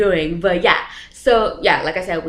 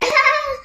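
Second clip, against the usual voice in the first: high squealing laugh; high giggling laugh; burst of laughter. Real, not acted. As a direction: let a real laugh break out. Real laugh mid-story.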